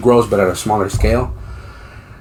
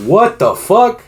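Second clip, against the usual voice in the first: shocked disbelief; 5 dB louder, slower than usual. Retold or imitated. Retold shocked reaction.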